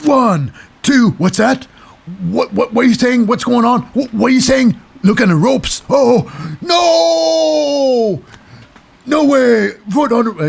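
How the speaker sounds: high, loud, frantic ref who can't see the ropes; flustered referee. Retold or imitated. Recapping the match, imitating the confused referee, high and frantic. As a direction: play a panicked ref scrambling for answers. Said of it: "the ref's like".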